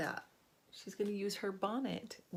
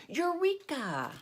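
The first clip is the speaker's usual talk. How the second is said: high, drawn-out cry of discovery; triumphant shout; 11 semitones higher, 4 dB louder, much slower than usual. A narrator's reading. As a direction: call it out high and slow, stretching it. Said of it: "I will shout".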